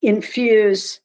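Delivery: slow, deliberate word for effect; drawn out with ironic care; mock-careful slow. Real, not acted. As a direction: spell it out slowly, tongue in cheek. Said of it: "I'll say this slowly".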